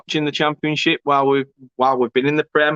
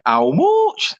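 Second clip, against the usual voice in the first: a light mock Yorkshire accent, a bit higher; Yorkshire twang; 5 semitones higher than usual. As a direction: tease a Yorkshire friend with a mild Yorkshire "How much?", rising at the end. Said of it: "pure, um, Yorkshire twang".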